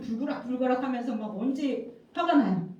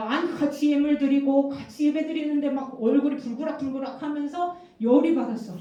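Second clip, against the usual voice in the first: ordinary talk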